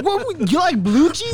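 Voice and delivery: high, drawn-out disbelieving question; outraged disbelief, pitch shooting up; incredulous. Real, not acted. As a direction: throw it back as if appalled. Challenging friend's taste in disbelief.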